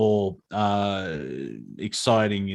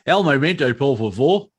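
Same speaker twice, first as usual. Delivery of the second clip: Spanish with a broad Australian accent; 4 semitones higher, 5 dB louder than usual. Shown as a demonstration. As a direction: say the Spanish in a thick Aussie accent. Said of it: "keep my Australian accent".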